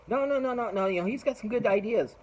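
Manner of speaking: high, hurried mock defence; high protest. Petition guy defends [name] to [name] in a high protesting voice. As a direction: squeak out a nervous denial.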